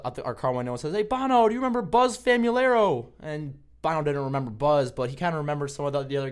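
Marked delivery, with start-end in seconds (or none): 0.9-3.1 calling out loudly, pitched way up; high, loud holler to get someone's attention; retold yell. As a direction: imitate a buddy yelling from a car window. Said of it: "he just yells out".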